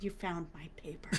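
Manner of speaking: low, very soft whisper; hushed, breath-only wonder; awed whisper. Retold or imitated. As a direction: whisper it as if overcome. Tearful thanks acted out in whisper.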